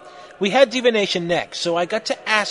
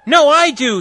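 high, loud retort; hammed-up shouted comeback; 9 semitones higher, 9 dB louder than usual. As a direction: shout back in a jealous row.

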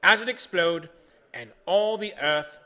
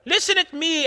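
high, loud demand; yelling; 12 semitones higher, 7 dB louder than usual. A narrator's reading. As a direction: yell to be heard. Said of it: "Edison yell".

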